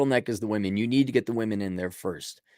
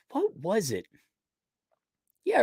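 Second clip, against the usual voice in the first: ordinary delivery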